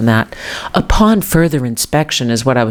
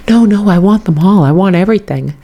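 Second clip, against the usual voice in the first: stubborn daughter; 6 semitones higher, 4 dB louder than usual. Retold or imitated. Daughter refusing to give up sweaters.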